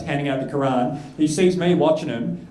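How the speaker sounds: ordinary talk, nothing marked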